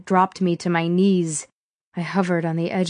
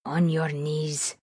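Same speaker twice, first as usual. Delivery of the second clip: slow, softly spoken threat; menacing villain; 3 semitones lower, 7 dB quieter, slower than usual. A narrator's reading. Armed villain to his captive, quiet menace. Benito, the villain, gives orders.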